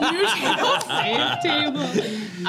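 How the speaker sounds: high laughing echo; laughing quote. Retold or imitated. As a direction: giggle through the line. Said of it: "when Sean is like".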